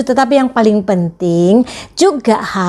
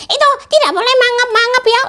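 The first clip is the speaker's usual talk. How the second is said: put-on much higher childlike puppet voice; puppet friend; 13 semitones higher, faster than usual. Teacher voicing her puppet for the children, high puppet voice. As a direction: play the cheeky puppet sidekick, pitched way up.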